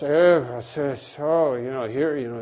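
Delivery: mock-tired grumble; weary imitation. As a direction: act worn out by a repeat visitor. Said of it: "God never gets tired and he goes".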